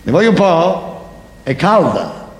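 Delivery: ordinary talk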